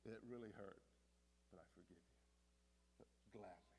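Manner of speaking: soft, low confession; gentle, subdued voicing of love; tender forgiveness. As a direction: forgive quietly, sinking almost to a hush. Acts out forgiving someone who hurt.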